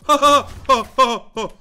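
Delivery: loud, high taunting laugh; mocking laughter. Real, not acted. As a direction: cackle at the beaten rival. Mocking laugh after winning.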